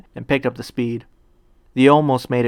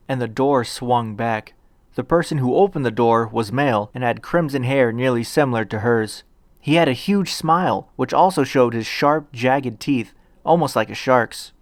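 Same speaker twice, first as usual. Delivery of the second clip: ordinary delivery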